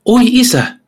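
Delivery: high, loud cry of a desperate daughter; shouted plea. Acted. Sin cries out to her father Satan, high and loud. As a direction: throw yourself between them, crying out high. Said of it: "ja huutaa".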